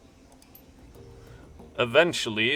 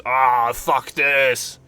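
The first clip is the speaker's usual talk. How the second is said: mock grumpy roar; 7 dB louder, much slower than usual. Dragon waking up grumpy, voiced.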